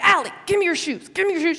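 high, frantic acted pleading; mock panic. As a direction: order a sibling in hammed-up panic, pitched high. Panicked order to sister, police behind.

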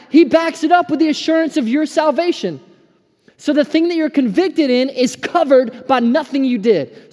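high, loud, exultant proclamation; fired-up preacher, pitched high and loud; elated preaching. Real, not acted. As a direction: proclaim it joyfully at the top of your range. Elated climax of testimony.

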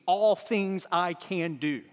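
slow, weighted "All things" at the front; emphatic all things. Shown as a demonstration. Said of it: "It literally reads".

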